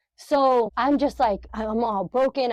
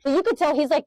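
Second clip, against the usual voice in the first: usual speaking voice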